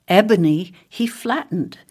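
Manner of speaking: ordinary delivery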